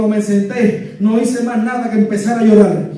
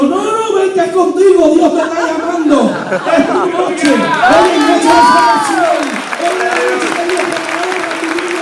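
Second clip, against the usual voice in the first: high, loud, slow preaching voice calling him forward; fervent old pastor; 7 semitones higher, 5 dB louder than usual. Retold or imitated. Old pastor calling the sinner to the altar, high and fervent. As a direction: stretch the words out like an old preacher pleading at the altar.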